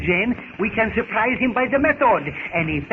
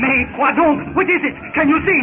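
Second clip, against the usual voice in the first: higher and louder, urgent alarm; alarmed cry; 7 semitones higher, 6 dB louder than usual. Acted. Darnell asking what is happening as natives rush, alarmed shouting. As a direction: call out in sudden alarm.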